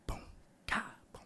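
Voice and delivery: whispered drum sounds; softly beatbox a drum beat. Retold or imitated. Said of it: "he plays on the drums, he's like".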